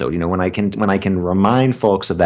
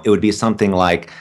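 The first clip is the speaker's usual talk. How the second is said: ordinary delivery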